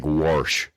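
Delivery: "warsh" with an r slipped in after the vowel; Oklahoma-style "warsh", with an added r sound; vowel shift. Shown as a demonstration. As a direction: say "warsh" for wash, Midwestern twang. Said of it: "they also have specific vowel shifts".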